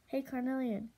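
usual speaking voice

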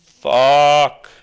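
one loud, drawn curse; loud angry curse; loud frustrated curse. Real, not acted. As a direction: let the anger out in one word. Frustrated at enemy escape.

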